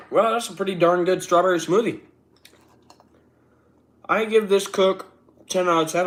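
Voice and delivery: loud and high with delight; excited. Real, not acted. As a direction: gush over the smoothie. Delighted smoothie verdict.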